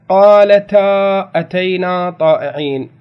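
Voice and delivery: وقالتا drawn out and loud, slowly; deliberate classroom recitation; slow emphatic recitation. Shown as a demonstration. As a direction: read the verse slowly with weight. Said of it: "recites the verse as an example".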